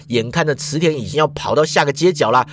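ordinary delivery, nothing marked

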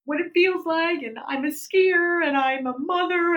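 high, overwrought mock wail; re-enacted tearful plea, slow and high; retold tearful outburst. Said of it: "all of a sudden I burst into tears".

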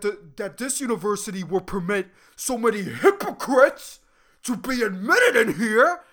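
loud mock-outraged declaration; worked up, angry. Student vowing to write to the Dean, imitated in loud indignation. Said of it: "she fucking said".

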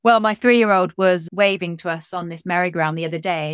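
normal talking voice

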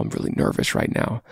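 put-on a hushed, vulnerable whisper to the girl; nervous whisper. Shown as a demonstration. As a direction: breathe it out like a scared confession. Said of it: "being as vulnerable as possible".

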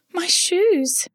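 high, distressed ballerina voice; crying ballerina. A narrator's reading. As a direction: wail it like a little dancer who lost her shoes. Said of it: "she cried".

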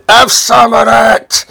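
high, slow, drawn-out shout; retold triumphant yell. Lol dumping coleslaw on the couple, drawn-out yell. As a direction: stretch it out as a mock battle cry.

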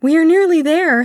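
high, loud, excited announcement; eager boy. A narrator's reading. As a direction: burst out with happy anticipation. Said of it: "said the boy".

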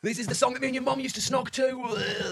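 high, slow, hammed-up tipsy reminiscing; mock drunk dad. Drunk dad telling kids about their song, hammed-up slow and high. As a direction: drag it out like a sozzled parent.

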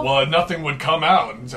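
ordinary delivery

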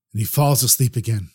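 usual speaking voice

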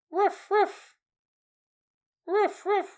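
high yappy woof woof; mimicking the dog barking at dawn; dog bark imitation.

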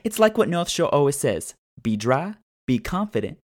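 high, loud, bubbly ad read. Acted. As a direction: sell it like a peppy commercial. Reads a sponsor ad.

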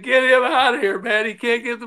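high exasperated order, mock shouted; half-playful furious boss; retold angry demand. Manager yells to GM about pitcher.

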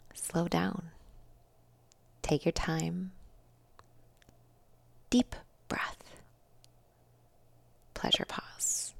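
soft, hushed breathing cue with long pauses; calming guidance. Real, not acted. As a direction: ease them into a pause, almost whispering. Guides listeners to pause and breathe.

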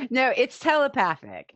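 amused reply with a laugh. Real, not acted. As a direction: laugh as you answer. Laughing answer.

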